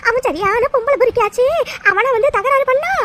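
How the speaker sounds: appalled and raising her voice; stunned outcry. Acted. Old woman shocked at news.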